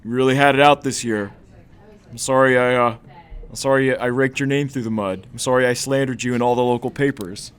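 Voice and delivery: hammed-up confession of past wrongs; emphatic mock apology. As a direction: apologize hard, playing it up. Acted apology in the skit.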